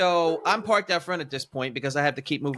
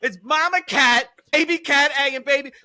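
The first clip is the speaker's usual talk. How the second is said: high, loud comic tirade; outraged disbelief at full volume; exasperated rant; 12 semitones higher, 7 dB louder than usual. Real, not acted. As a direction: build into an incredulous, high-pitched rant. Climactic rant about nameless cats.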